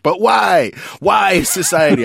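high, loud protesting wail; hammed-up teenage-style cry of injustice; feisty. Dad replaying his argument with daughter about hats, feisty. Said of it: "feisty mood".

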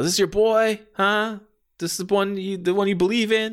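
acted villain's goading; mocking taunt. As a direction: jeer at his mother. Wrestler taunting opponent's mom.

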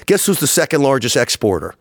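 ordinary talk

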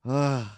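loud, high exasperated sigh; exasperated groan. Acted. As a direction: let out a fed-up groan. Exasperated after acting out screaming.

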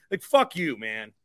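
ordinary delivery

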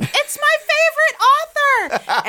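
loud, high-pitched excited yell; retold fan scream. Retelling spotting friend at a local brewery, screaming excitedly. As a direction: re-enact screaming at your favorite author. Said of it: "I immediately started screaming".

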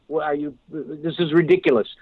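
ordinary delivery

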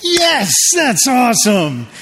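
high, slow, beaming praise; proud pastor cheering the room; delighted praise. Real, not acted. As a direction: cheer them on for getting it. Praising the crowd's recitation.

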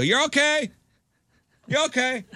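put-on calming a screaming boy on the trail; reassuring call. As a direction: shout comfort up the hill to a hurt kid. Calling up to a screaming kid.

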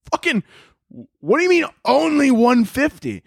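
high-pitched incredulous outburst; indignant retort. Retold or imitated. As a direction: retell it as an exasperated yelp. Indignant retort to price remark.

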